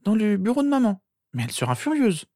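put-on high girl's voice, reluctant; troubled sister. A narrator's reading. Sister revealing where it is, uneasy. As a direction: give in, pitched high and uneasy.

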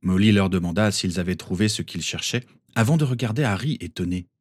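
plain reading voice

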